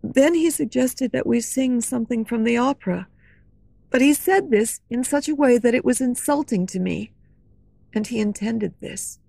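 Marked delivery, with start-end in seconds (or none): none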